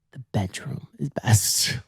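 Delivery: breathy secret whisper, slow. Retold or imitated. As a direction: lean in and whisper it slowly. Retold bedroom suggestion.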